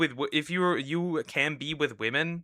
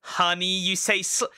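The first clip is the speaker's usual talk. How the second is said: mocking impression; 6 semitones higher, slower than usual. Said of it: "it just get".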